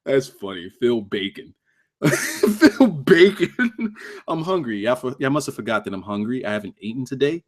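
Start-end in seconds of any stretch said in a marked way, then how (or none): 2.0-3.9 high, drawn-out words breaking into giggles; laughing. Real, not acted. Streamer repeating the silly nickname from chat, laughing. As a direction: say it slowly through real laughter.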